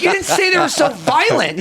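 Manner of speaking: high-pitched mock-frantic complaint; panicked protest. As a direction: protest to your buddy, voice way up high. Retold panicked protest to buddy.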